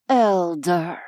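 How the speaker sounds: low, contemptuous address; bitter disdain. A narrator's reading. Unseelie faces the elder, bitter disdain. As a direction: drip disdain on the word. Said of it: "his voice dripping with bitterness and disdain".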